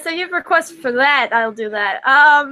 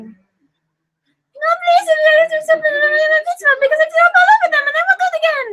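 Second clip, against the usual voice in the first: much higher, fast, half-sung whine; high pleading voice; 12 semitones higher, 3 dB louder than usual. Acted. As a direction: beg in a squeaky sing-song. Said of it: "attempting to do as many voices".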